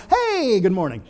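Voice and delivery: high, loud burst of put-on cheer; fake cheery greeting. Couple fresh from a car fight greeting church, falsely cheery. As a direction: swoop up high on a big sudden hello. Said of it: "we walk in the church door just angry".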